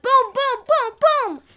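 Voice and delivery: high chanted boom boom; cannon booms. Pretend. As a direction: mimic cannons going off. Imitating cannon fire.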